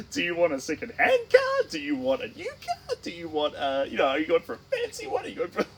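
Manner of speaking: high-pitched mock panic; squeaky, hammed-up floundering; flustered dealer. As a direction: babble options as a stumped car salesman. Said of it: "the poor dealer would be like".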